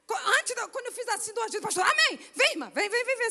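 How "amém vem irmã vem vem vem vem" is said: eager call forward. Retold or imitated. Pastor calls her forward.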